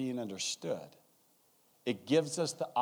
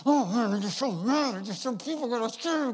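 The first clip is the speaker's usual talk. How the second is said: angry Don; 10 semitones higher, 4 dB louder than usual. Retold or imitated. Don arrives frothing mad.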